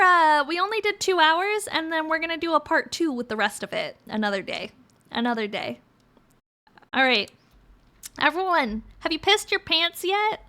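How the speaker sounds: ordinary delivery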